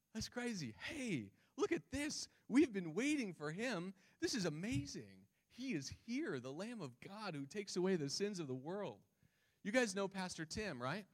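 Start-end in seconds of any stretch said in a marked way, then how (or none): 0.8-9.0 high-pitched, astonished delight; amazed announcement. Acted. Preacher acts out John spotting the Lamb of God, amazed. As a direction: announce a long-awaited sighting in wonder. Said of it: "used to indicate surprise".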